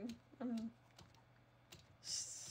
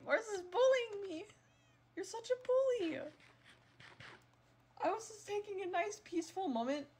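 put-on much higher, louder childish whine; whiny tattling; 9 semitones higher, 4 dB louder than usual. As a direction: tattle like a kid to the teacher.

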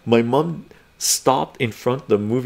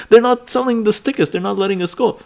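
put-on kid brother; 9 semitones higher than usual. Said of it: "My brother goes over and says".

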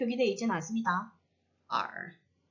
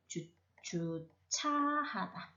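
주차하다 very slow and softer, syllable by syllable; drawn-out syllables; 4 semitones lower, 9 dB quieter, much slower than usual. Shown as a demonstration. Teacher giving students the verb meaning, slow syllables. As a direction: stretch out 주차하다 as if writing it.

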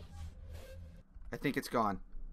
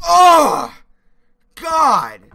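loud, high shocked cry; startled cry; 10 semitones higher, 17 dB louder than usual. Real, not acted. Startled after dying.

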